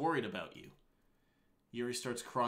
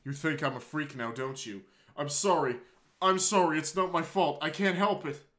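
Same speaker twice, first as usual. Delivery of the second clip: tearful; 4 semitones higher, 8 dB louder than usual. Acted. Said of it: "Yuri continues to sob".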